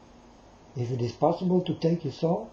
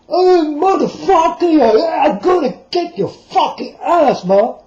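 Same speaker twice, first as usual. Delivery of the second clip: much higher, loud screaming threat; mock furious yell; 12 semitones higher, 9 dB louder than usual. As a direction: scream it like a brawler. Acts out an angry retort.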